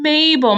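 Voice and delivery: MABEL with a long hummed m; stretched mmm at the start of Mabel; held M. Shown as a demonstration. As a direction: hum the M before the rest of Mabel. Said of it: "my lips are pushed together".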